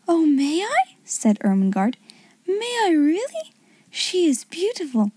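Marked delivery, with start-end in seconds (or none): 0.1-0.9 put-on high, eager little-girl voice; eager, high-pitched wonder; thrilled asking. A narrator's reading. Girl thrilled to hold the doll. 2.5-5.1 put-on high-pitched, delighted child; wondering little-girl delight; thrilled wonder. A narrator's reading. Girl thrilled to hold the doll.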